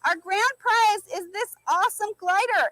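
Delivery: usual speaking voice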